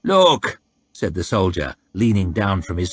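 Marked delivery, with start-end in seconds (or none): 0.0-0.6 coarse slaver. Acted. Soldier haggling.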